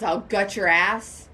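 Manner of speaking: slow imitation with "gut" oddly leaned on; misplaced emphasis. Co-host mimicking a movie line's odd delivery, misplaced emphasis. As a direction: lean hard on "gut", drawing out "I'll gut your ass". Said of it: "But the way he said it was".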